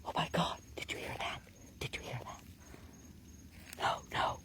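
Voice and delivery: urgent breathy whisper in the dark. Real, not acted. Investigator whispering to Dale after the call, urgent whisper. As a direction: hush it, afraid to scare it off. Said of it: "I'm being quiet because I heard".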